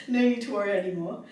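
ordinary talk, nothing marked

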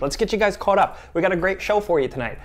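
ordinary talk

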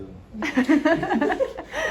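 loud high-pitched laugh; real laughter. Real, not acted. Laughs after flipping the cat. (tiz, yüksek kahkaha)

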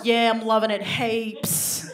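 slow, put-on Aussie accent; attempted Australian bedroom talk. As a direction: draw it out in a hammed-up Australian accent. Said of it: "Australians, how was that?".

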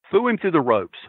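ordinary delivery

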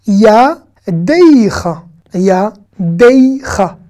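YADEICHA very slow, with a clear "ey" glide in DEI; pronounced yud. Shown as a demonstration. Teacher showing learners the sounded yud, slowly drawn out. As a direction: stretch "Yadeicha" as ya, dey, cha. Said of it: "il a tendance à se prononcer".

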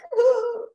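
high little sob. A narrator's reading. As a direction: let out a sob. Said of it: "Rocky cried".

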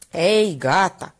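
slow, irritated cut-off; annoyed exclaim. Acted. Pharmacist silencing Minerva, slow irritated exclaim. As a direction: brush her off, fed up. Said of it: "exclamă agasat".

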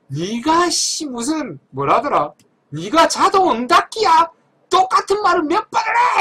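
slow, high furious yell building to a shout; angry shout. Retold or imitated. Boss yelling at a call center agent, angry shout. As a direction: blow up at the agent. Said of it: "이러면서 막 싸우시더라고".

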